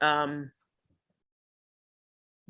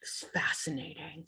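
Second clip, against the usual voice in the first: sarcastic whisper of awe; 13 dB quieter than usual. Acted. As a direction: breathe it as mock wonder. Mock interest in tiling.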